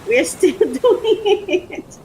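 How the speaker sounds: high, drawn-out, laughing words; joyful laughing. Real, not acted. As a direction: laugh through the words, stretching them out. Overjoyed, laughing as she repeats herself.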